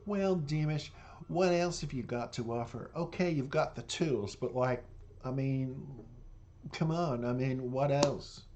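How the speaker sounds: a touch of a condescending talent-show judge; dismissive judge impression. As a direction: sneer it out like the bored blonde judge. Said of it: "I went".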